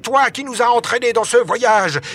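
high, heated demand; angry accusation. A narrator's reading. Barbicane blames Michel for the voyage, angry. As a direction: hurl the blame at him.

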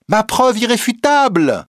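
high-pitched, triumphant declaration; triumphant insistence. A narrator's reading. Reporter answers the president about his proof, triumphant. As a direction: declare it with total conviction.

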